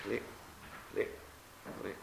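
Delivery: each "click" soft and spaced by a long pause; slowing clicks. Acted. Knitter dozing off at her needles, slow sparse clicks. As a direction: let every "click" come later, winding down. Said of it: "the clicks began to get slower".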